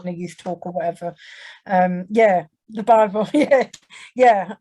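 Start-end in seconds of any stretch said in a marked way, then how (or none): 1.7-4.5 laughing yeah. Real, not acted. Speaker laughing to the interviewer in recognition. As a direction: laugh it off as the memory comes back.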